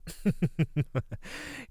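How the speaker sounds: high, quick rippling laugh; wicked chuckle. Acted. Creature after mentioning its meals, high wicked chuckle. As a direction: chuckle with relish at the threat.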